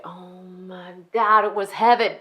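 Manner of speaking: hammed-up food ecstasy; ecstatic relish. Relives gorging on forbidden pickles.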